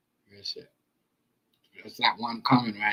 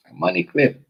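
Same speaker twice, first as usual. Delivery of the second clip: higher, loud flat confirmation; raised, loud sardonic repeat; knowing scoff; 8 semitones higher, 11 dB louder than usual. Real, not acted. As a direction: land it like a punchline. Repeats in disbelief.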